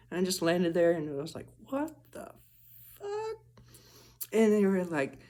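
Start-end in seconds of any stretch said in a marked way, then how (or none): 1.7-3.4 very high, soft stunned squeak; high, breathy what-the-heck; shocked disbelief. Retold or imitated. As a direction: gasp it out in shock. Shocked reaction to weird video.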